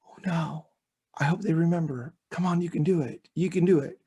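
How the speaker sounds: anxious rooting audience. Acted. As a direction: act out a silent, hopeful cheer from the audience. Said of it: "your thought is almost like".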